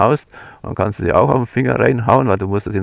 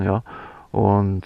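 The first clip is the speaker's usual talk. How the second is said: ordinary delivery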